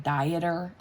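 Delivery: "dieter" as DIE-uh-ter, like diet; spelling-based misreading. Shown as a demonstration. Explaining how the name looks written down, "dieter" said DIE-uh-ter. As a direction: read "dieter" as diet plus -er. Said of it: "if you write it down, it looks like".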